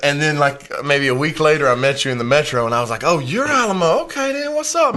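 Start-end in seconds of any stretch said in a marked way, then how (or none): 3.1-4.9 high-pitched realization and greeting; retold sudden recognition. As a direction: act out putting a face to a name, pitch leaping. Retelling recognition on first meeting.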